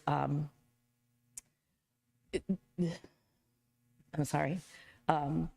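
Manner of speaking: choking back tears; teary. Real, not acted. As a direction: voice catching, near tears. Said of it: "I'm sorry if I get teary here".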